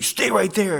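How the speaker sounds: imitating a bartender yelling stay put; loud high order. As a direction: bark it like a worried big friend. Big bartender ordering him.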